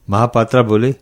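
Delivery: usual speaking voice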